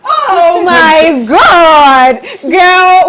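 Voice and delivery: loud, high, stretched cry of delight; excited shout. Real, not acted. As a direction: scream it out in awe. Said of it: "I have chills on my body".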